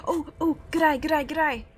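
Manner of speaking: put-on a playful Australian accent on good eye. Gamer praising the game's Harry in an Australian accent. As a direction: toss off good eye the Aussie way. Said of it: "that sounded really Australian".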